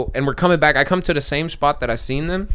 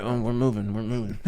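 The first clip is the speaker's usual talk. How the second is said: sheepish mumble; 4 semitones lower, 9 dB quieter than usual. Retold or imitated. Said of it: "looked at me and was like".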